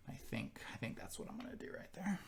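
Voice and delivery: very soft, half-whispered thinking aloud; muttering to self. Real, not acted. Player pondering a move to themselves, hushed muttering. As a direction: think it over under your breath.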